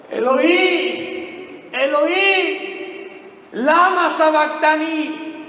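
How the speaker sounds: loud, high, slow wrenching scream; anguished cry. Acted. Preacher acting Jesus on the cross, screaming high. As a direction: tear the words out in agony. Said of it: "Y va a gritar".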